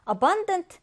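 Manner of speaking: "abundant" with a strong middle -BUN-; light a-, stressed -bun- in "abundant"; stress on second syllable. Shown as a demonstration. Teacher repeats how to read it, "abundant" stressed on the second syllable. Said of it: "이렇게 읽어줍니다".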